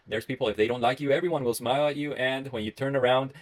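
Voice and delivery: ordinary talk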